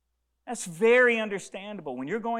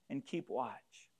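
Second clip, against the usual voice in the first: hushed; 6 semitones lower, 11 dB quieter, slower than usual. Acted. Jesus quietly asking disciples.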